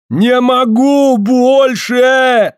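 slow, high wailing cry; desperate wail. Acted. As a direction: wail it out in panic. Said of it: "завопил на корме Смольков".

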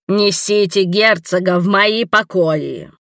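slow, stern commanding witch. Acted. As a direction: order it sternly, slowly. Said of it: "строгий голос ведьмы".

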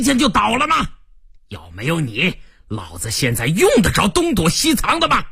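accusing crime boss, pitch leaping up; angry tirade rising into high outbursts; furious gang boss. Acted. Narrator voices angry gang boss. (黑帮老大愤怒质问)

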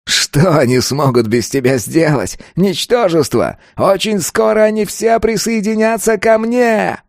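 high, contemptuous taunt, climbing; gloating villain. A narrator's reading. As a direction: build to a high triumphant cry. Killer taunts falling enemy. (злорадно, всё выше)

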